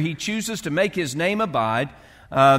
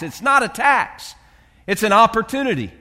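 rising preaching fervour; 5 semitones higher, 3 dB louder than usual. Real, not acted. Preacher building to climax.

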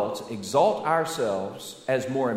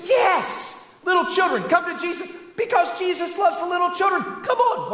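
mock welcoming disciples. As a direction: ham up a gushing disciple calling the kids in.

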